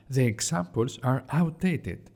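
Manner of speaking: ordinary delivery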